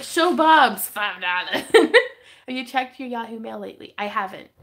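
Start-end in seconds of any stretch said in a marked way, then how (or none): 0.0-1.6 slow, louder, laughing quote; laughing mock quote. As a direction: drag it out while laughing. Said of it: "It's like".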